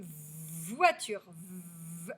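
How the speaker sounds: VOITURE with a long buzzing v, then V alone; elongated initial v. Shown as a demonstration. Teacher shows the first sound, "voiture, v-" drawn out. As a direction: hold the v of "voiture". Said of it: "c'est bien le son v".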